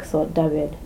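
plain reading voice

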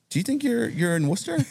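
high disbelieving cop. Retold or imitated. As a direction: pitch it up, mocking the lost drunk.

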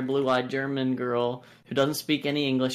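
ordinary delivery

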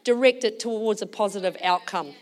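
loud, emphatic exhortation; preaching. Real, not acted. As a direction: urge the congregation with force.

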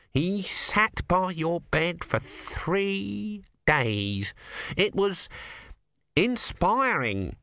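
slow awestruck monk; awed Numrod. A narrator's reading. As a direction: marvel at it like a devoted underling. Numrod in awe.

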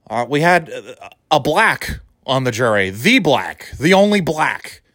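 ordinary talk, nothing marked

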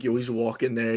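usual speaking voice

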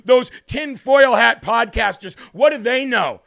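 loud, slow sing-song sneer; shouted mock sing-song of the critics. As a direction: ham up the scoffers mockingly. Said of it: "sick and tired of people".

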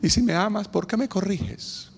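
a hint of a crying daughter; daughter's plea. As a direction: plead like a hurt little girl. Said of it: "Y ella dice".

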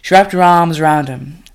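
ordinary delivery, nothing marked

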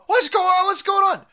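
high-pitched shouted whine, played for ridicule; mock bewildered victim. Mocking the beaten shop owner, shrill shout of confusion. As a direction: squeal it like a clueless shop owner. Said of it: "he's sitting there going".

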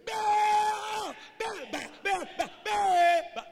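repeated high, desperate-sounding yells; shouting. Acted. Prophets of Baal calling their god, shouting. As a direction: bellow the name over and over. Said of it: "They started shouting".

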